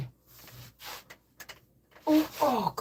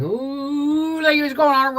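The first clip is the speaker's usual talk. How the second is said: ride ooh; 10 semitones higher, 6 dB louder than usual. Retold or imitated. Mimics ride sound.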